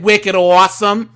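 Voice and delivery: loud Boston slang accent; hammed-up Boston voice. Shown as a demonstration. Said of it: "in the Boston accent".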